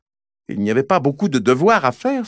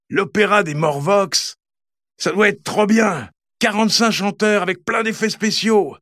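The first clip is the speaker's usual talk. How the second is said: dreamy excitement; 7 semitones higher than usual. Acted. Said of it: "Anatole en rêve tout haut".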